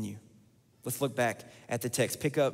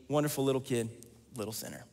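normal talking voice

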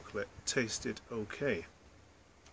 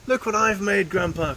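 put-on high, loud excited child's cry; 6 semitones higher, 7 dB louder than usual. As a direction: call out proudly to Grandpa, high and loud. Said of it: "cried George".

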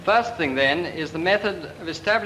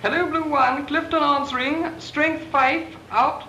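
crisp, formal radio call, pitched higher; radio procedure call; 9 semitones higher than usual. Shown as a demonstration. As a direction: call up the station by the book over the RT. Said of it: "make contact with me in the way I outlined".

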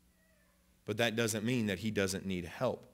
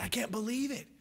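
very high voice imitating amazed gratitude; overcome with disbelief; 13 semitones higher, much faster than usual. Man receiving a gift, imitated in high-pitched amazement. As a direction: act out a man who can't believe it. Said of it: "you could see it on his face".

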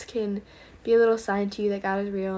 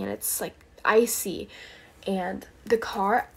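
ordinary talk, nothing marked

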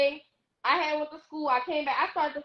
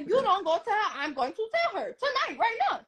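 rising high and insistent, half laughing; retold ultimatum with a laugh breaking in; 6 semitones higher than usual. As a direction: push her to tell, pitch climbing.

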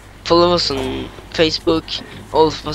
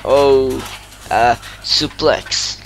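wrestling commentator. Pretend. Kid calls the match live.

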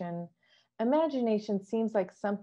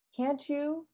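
much higher, a mild pitying lilt; 9 semitones higher than usual. Acted. Queen pitying Alice's disbelief, high mild pitying question. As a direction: pity her gently, up high. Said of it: "the Queen said in a pitying tone".

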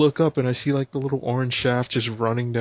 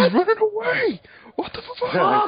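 panicked shriek; slower than usual. Real, not acted. Panic during heist.

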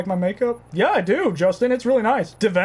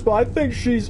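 ordinary talk, nothing marked

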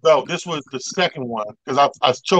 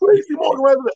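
high bouncer voice; 12 semitones higher, faster than usual. Retold or imitated. Bouncer names Tracy.

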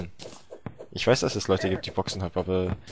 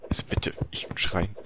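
whisper; 5 dB quieter than usual. Acted. Mock denial of screaming.

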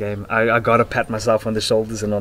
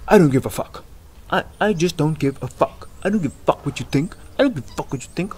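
higher-pitched mock tough talk; mock hard-ass; 5 semitones higher than usual. Vlogger imitating a hard ass who doesn't care, mock tough. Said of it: "I'm not like".

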